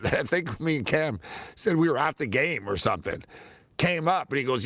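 ordinary delivery

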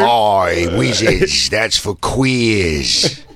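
put-on salty old sea dog, very slow; gruff old fisherman. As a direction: growl it like a crusty old sailor. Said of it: "old salty fucking wizard".

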